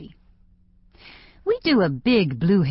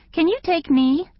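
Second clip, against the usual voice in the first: high, perky client's question; teenage client; 12 semitones higher than usual. A narrator's reading. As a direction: ask it high, like a hopeful customer. Client asks stylist for appointment.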